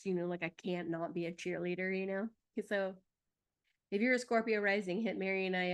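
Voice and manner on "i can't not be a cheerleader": ordinary delivery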